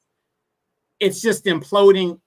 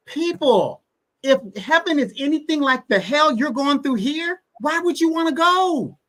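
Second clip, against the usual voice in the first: pleading rise; 8 semitones higher than usual. Real, not acted. Rhetorical challenge to the audience.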